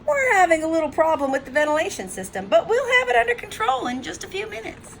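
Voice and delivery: high-pitched official reassurance; pacify a crowd in a singsong high voice; placating cruise director. Acted. Cruise director placating angry passengers.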